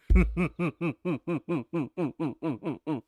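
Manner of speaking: high villain cackle; Doflamingo laugh. Shown as a demonstration. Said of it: "Laugh like Doflamingo?".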